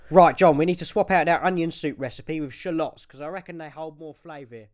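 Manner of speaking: fast, fading away into the distance; brisk fading chatter. Acted. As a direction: rattle it off as you walk away. Father fading out of earshot.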